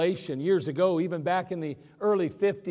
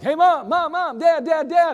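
high, loud imitation of excited kids; pestering children; 8 semitones higher, 7 dB louder than usual. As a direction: play impatient kids calling over and over for attention. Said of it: "they come running up to us and they're like".

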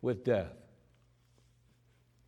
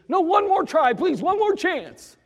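much higher pleading for mercy; desperate begging; 11 semitones higher, 5 dB louder than usual. Acted. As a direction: plead for one more chance as they drag him off. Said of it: "taking him out to execute him".

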